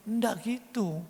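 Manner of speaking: normal talking voice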